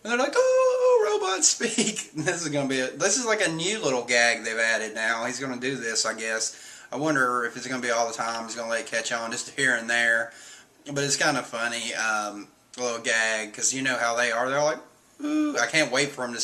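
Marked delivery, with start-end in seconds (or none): 0.3-2.1 very high, loud delighted gasp; amazed squeal. Retold or imitated. Crew amazed by robot voice. 15.2-15.6 high amazed ooh. Retold or imitated. Crew amazed reaction.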